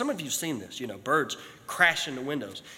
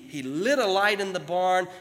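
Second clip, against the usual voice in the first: ordinary talk, nothing marked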